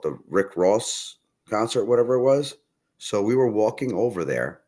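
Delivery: ordinary talk, nothing marked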